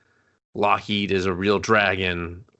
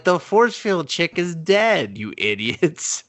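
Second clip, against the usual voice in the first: exasperated mock scolding; 8 semitones higher, slower than usual. Viewer scolding dumb mutants, slow and high in disbelief. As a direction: spell it out slowly like to dimwits. Mocking characters' stupidity.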